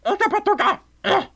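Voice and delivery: high alien creature gibberish; Stitch. Acted. Streamer back in the cartoon voice for viewers, high Stitch impression. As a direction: squawk and growl like a cartoon alien. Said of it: "do an even better Stitch voice".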